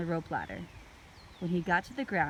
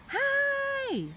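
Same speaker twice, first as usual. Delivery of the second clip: very high, louder call; 4 dB louder than usual. A narrator's reading. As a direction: call to the creature below. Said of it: "Annie called again".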